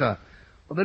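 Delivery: ordinary talk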